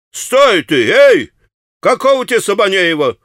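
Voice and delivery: put-on yelling after the boy; calling out. A narrator's reading. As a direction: stop him as he walks off.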